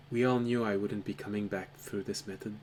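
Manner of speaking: a distant, low-quality voice that comes through crackly; muffled voice over a poor microphone; bad microphone voice. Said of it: "speaking in a microphone that was very low quality".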